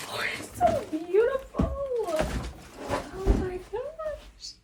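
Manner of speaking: high, very slow, drawn-out swoon; swooning awe. Real, not acted. Vlogger admiring her new sewing machine, swooning high and slow. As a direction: stretch every word like you're melting.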